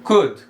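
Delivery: "could" with only a u sound in the middle; "could" as a model word, short u; correct pronunciation. Shown as a demonstration. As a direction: say "could" like "kud", L silent. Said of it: "como se tivesse só um U ali no meio".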